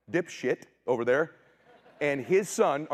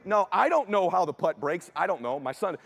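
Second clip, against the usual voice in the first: normal talking voice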